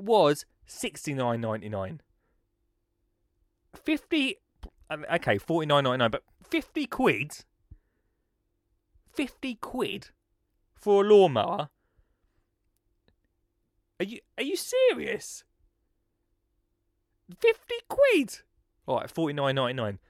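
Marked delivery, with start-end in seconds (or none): 3.7-4.4 mock disbelief. 6.4-18.4 high squeaky mock astonishment; hammed-up disbelief. Host pretending to be shocked by the cheap lawnmower, squealing.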